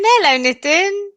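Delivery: high, loud cheerful hello; excited greeting. Real, not acted. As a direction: call out hello to a viewer. Greeting viewer loudly.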